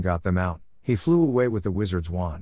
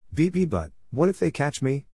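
stammering, higher-pitched nervous voice; 6 semitones higher than usual. A narrator's reading. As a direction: stumble into it nervously, pitch raised. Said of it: "said Dean nervously".

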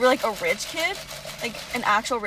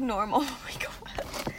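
whispered giggle; 6 dB quieter, slower than usual. Real, not acted.

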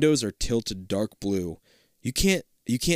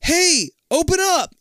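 high, loud, slow yell; 7 dB louder, slower than usual. Acted. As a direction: yell through a closed door. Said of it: "Jake yelling through the door".

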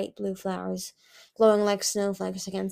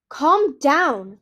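loud, high shout to calm someone; 6 semitones higher, 10 dB louder than usual. A narrator's reading. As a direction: shout it urgently. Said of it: "Kibble shouted in his ear".

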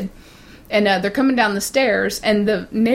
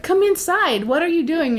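much higher, calling across the yard; mother calling out; 7 semitones higher than usual. Retold or imitated. Mother calls her screaming daughter inside, high and urgent. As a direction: call the crying daughter in. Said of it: "And the mother's like".

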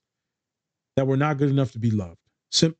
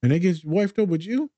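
high excited hype; 7 semitones higher than usual. Retold or imitated. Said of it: "I said".

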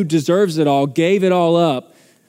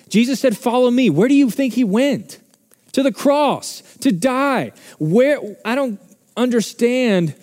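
higher, impassioned pleading; worked up; 9 semitones higher, 3 dB louder than usual. Real, not acted. Pastor pressing the church about the cross, worked up. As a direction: let the passion run away with you, slowing at the end. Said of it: "I'm getting a little worked up here".

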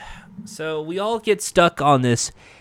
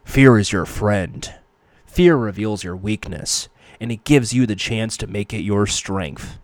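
Batman voice; 7 semitones lower than usual. Acted. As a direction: drop into a low Batman. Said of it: "I'll try and do a Batman voice".

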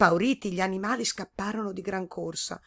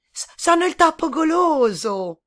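high, loud squeaky voice with a nervous stammer; a little mouse caught off guard; timid stuttering mouse; 9 semitones higher, 10 dB louder than usual. A narrator's reading. Mouse answering the frog, squeaky and stammering.

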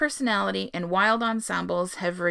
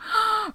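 excited gasp; 14 semitones higher than usual. Real, not acted. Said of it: "I'm so excited".

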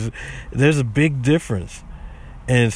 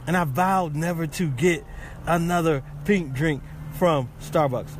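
normal talking voice

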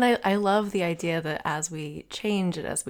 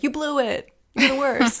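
higher, louder scolding of oneself; harsh inner critic; 8 semitones higher, 5 dB louder than usual. Retold or imitated. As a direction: berate yourself like a harsh critic. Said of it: "I'm like".

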